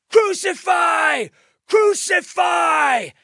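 very high, loud, drawn-out yell; mob shouting. A narrator's reading. Priests and officials demanding death, full shout. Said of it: "they shouted".

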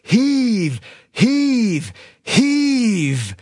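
high, drawn-out work chant with falling tone; rhythmic heave-ho call, mildly shouted; narrated team shout. A narrator's reading. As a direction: call each pull out long and high.

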